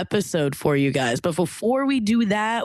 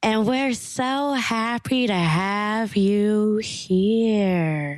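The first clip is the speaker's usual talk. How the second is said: wendigo voice. Acted. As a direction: creep them out like a wendigo. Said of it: "[name], what's this voice?".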